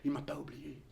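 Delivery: "Il m'a pas oublié" dit à mi-voix, incrédule; tout bas. Acted. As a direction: murmure "Il m'a pas oublié" pour soi. ("Il m'a pas oublié" dit tout bas)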